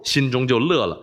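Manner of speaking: ordinary delivery, nothing marked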